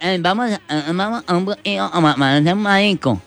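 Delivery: high voice with swallowed consonants; cleft-lip boy caricature. Acted. Boy inviting friends to play, high cleft-lip voice. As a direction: stammer it high, consonants missing. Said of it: "Ahí la agarré".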